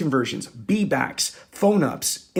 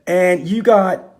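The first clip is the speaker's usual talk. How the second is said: fired-up rant; 5 semitones higher, 7 dB louder than usual. Real, not acted. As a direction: preach it like a fed-up coach. Ranting at dealership numbers.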